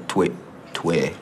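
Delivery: slow, exaggerated Québécois joual accent; Québécois accent drill. Shown as a demonstration. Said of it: "J'ai commencé à travailler sur mon accent".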